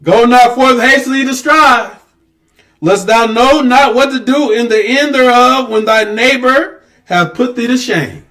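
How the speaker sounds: loud, slow, high preaching read; scripture proclamation. Acted. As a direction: proclaim each word slowly. Reading scripture with force.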